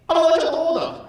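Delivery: high, loud phone caller; squeaky customer on the line. Retold or imitated.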